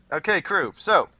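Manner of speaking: punchy announcement to the table; calling to attention. Real, not acted. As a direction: round up the group briskly. Game master calls group to attention.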